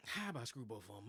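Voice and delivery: ordinary delivery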